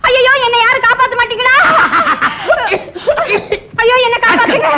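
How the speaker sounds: crying for help. Acted. Woman begs someone to save her, wailing. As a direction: wail out to anyone who might rescue you.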